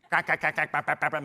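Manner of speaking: rapid-fire imitated quacking; staccato duck-call impression; duck noises. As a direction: quack like a duck caller at work. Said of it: "making all these duck noises".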